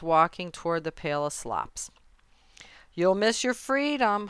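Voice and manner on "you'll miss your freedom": mild, higher-pitched honk; lightly voiced goose call. A narrator's reading. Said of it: "honked the goose".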